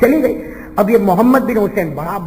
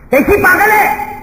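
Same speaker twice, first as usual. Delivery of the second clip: scoffing; 11 semitones higher, 4 dB louder, slower than usual. Acted. Rich master scoffs at her.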